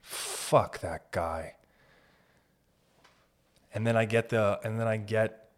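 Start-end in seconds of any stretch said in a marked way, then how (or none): 0.0-1.5 a hint of a sour critic, lower and slow; grumbling critic. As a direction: sneer it out like a disgruntled voter. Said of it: "that I was like".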